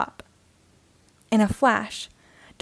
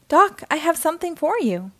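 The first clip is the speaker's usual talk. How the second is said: calling; 6 semitones higher than usual. Acted. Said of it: "calls Doc's mom".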